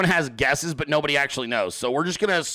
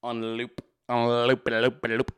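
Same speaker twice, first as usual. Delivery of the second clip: on loop, on loop, fading repeats; looping echo; 6 semitones lower, slower than usual. Shown as a demonstration. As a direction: let the words loop like a stuck track. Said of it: "singing Leah memes on, on loop".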